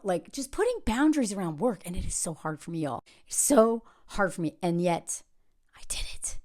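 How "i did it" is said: hushed, secret pride; soft whispered triumph. Real, not acted. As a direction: whisper it like a confession. Quiet private triumph.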